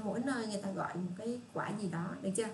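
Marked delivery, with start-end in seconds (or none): none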